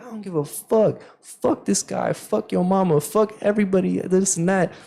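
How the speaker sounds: high, defiant cussing at normal volume; retold trash talk. Player told to chill out, retold high defiant mouthing off. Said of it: "running my mouth at him".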